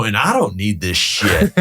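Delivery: slow, measured dismissal; retold firm refusal. Broker first refusing the rude tenant, firm and slow. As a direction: draw the words out, retelling a firm brush-off.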